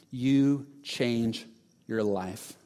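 drawn out low and quiet; slow hushed payoff of the refrain; slow, soft climax. Acted. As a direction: land the last line slowly and softly.

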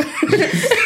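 high laughing burst. Real, not acted. Laughing at family quote.

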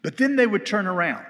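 ordinary talk, nothing marked

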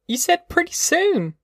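put-on very high-pitched, indignant girl's voice; angry cry. A narrator's reading. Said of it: "she cried angrily".

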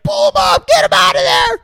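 high, loud desperate shout to haul him up; frantic yelling. Retold or imitated. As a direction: scream the order like the crew is panicking. Crew yelling to pull Hooper.

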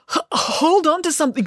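high-pitched, stuttering warning; urgent stammer. A narrator's reading. Joe grabs Finn's wrist, urgent stammer. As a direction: stammer on the first sound, urgently.

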